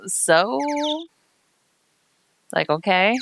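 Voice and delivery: ordinary talk, nothing marked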